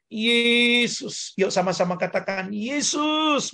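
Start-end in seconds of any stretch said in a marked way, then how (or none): 0.1-1.4 raised-pitch drawn-out call of YESUS; demonstrated prayer call. Shown as a demonstration. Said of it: "Panggil Yesus". 2.5-3.5 high, long call of "Yesus"; demonstrated high cry. Shown as a demonstration. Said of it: "Berteriak, yuk".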